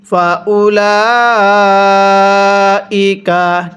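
ফাউলাইকা with lam held four alif long, very slow; slow Quranic chant; four-alif stretch. Shown as a demonstration. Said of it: "লামের মধ্যে আমরা টানটা দিব".

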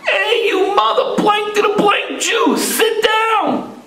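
high, hostile shouted heckle; retold scream. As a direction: yell it at the fan in front of you. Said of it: "some guy screamed".

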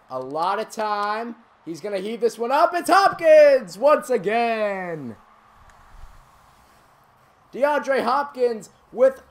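high, loud call building to a drawn-out shout; excited play-by-play. Acted. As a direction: build suspense, then bellow the catch. Play-by-play of long pass.

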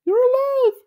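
very high awed cartoon voice; squeaky amazement; Pokey's high voice. A narrator's reading. Pokey marvels at Gumby, very high voice. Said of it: "Pokey said".

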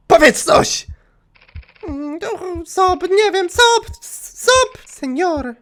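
very high, strained victim voice; high pleading girl. Acted. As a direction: squeak it like a sobbing victim. Gracz gra ofiarę. (bardzo wysoki, błagalny głos ofiary)

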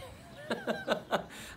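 very high, breathy squealing laugh; giddy giggle. Acted. Man hoping for many years of marriage, giddy giggle. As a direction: burst into high nervous-sounding giggles.

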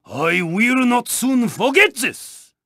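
ordinary talk

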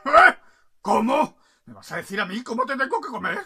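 loud, affronted outburst; outraged king. Acted. King answers the bird on his plate, loud outrage. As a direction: bluster at the cheeky bird.

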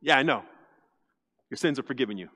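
low, soft, unbothered; calm quiet Jesus. Retold or imitated. Jesus calmly answering the crowd, soft and low. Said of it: "they want you to fix him".

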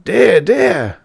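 high, loud anguished dear, dear; distressed cry. Acted. Flustered professor dismayed by his pupil's grievance, crying out high. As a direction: wring it out in dismay. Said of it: "the professor cried in great distress".

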